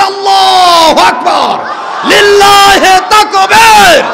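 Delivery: high, full-force drawn-out shout; loud slogan. Real, not acted. Preacher leads the takbir, shouting at full force. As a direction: lead the crowd in a roaring chant. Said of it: "জোরে সোরে বলে".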